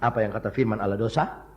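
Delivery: ordinary talk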